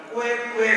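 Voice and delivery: high, even quack from afar; imitated duck quack. Preacher mimics a sound heard from afar, imitated quacking. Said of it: "Dari jauh terdengar lagi".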